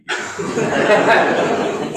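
breathy high laugh; amused laugh; high unvoiced laugh. Real, not acted. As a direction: let a laugh burst out. Laughing at human life.

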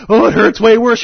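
high, loud mock whine; complaining dragon. Dragon complains to the teacher, in a high, loud mock whine.

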